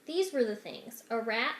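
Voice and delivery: plain reading voice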